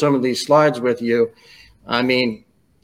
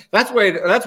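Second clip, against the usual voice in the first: laughing; 7 semitones higher than usual. Real, not acted. Laughing at the tease.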